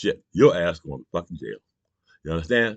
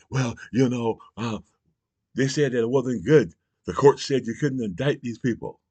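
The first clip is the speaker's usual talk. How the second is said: higher mock voice of the other side; mocking Republicans; 7 semitones higher than usual. Mocking Republican excuses to his viewers, a higher mock voice. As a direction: mimic their excuse with a sneer. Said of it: "they're gonna say".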